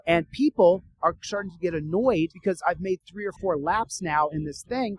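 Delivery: usual speaking voice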